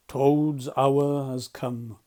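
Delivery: slow, grave and loud; solemn. Acted. Badger solemnly warns his friends of Toad. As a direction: announce doom slowly and weightily. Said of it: "he said solemnly".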